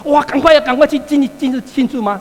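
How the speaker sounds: a hammed-up cheerful call; mock happy shout. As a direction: sarcastically act out an eager rush to celebrate. Mock: elder brother happily joining.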